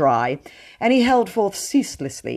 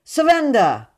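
loud, high demand; cried out; 10 semitones higher, 6 dB louder than usual. A narrator's reading. A policeman orders Dick Savage to surrender, loud cry. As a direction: shout it at the prisoner. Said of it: "cried one".

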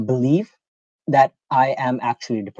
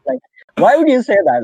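high, loud disbelief; incredulous; 11 semitones higher, 6 dB louder than usual. Real, not acted. Friend baffled by what Google says, in loud disbelief. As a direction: asks it in loud, high outrage. Outraged at a dumb claim.

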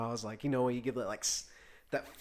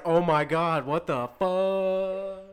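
long drawn-out expletive fading like a passing car; retold shock, last word hugely elongated; Doppler-effect swear; 3 semitones higher, much slower than usual. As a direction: stretch the final word way out and let it slide away. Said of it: "Doppler fuck?".

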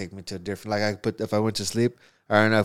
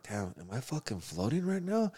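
soft, higher, rising wondering question; retold bewilderment; 4 semitones higher, 6 dB quieter than usual. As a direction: replay a dazed thought, lifting at the end. Retells bewildered thought while tripping.

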